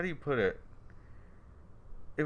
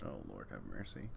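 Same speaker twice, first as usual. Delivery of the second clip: low dismay; 14 dB quieter than usual. Real, not acted. Dismayed exclamation.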